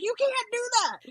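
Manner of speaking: high-pitched scolding on the edge of laughter; mock-mad friend. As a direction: imitate an outraged friend, amused underneath. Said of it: "so mad at me".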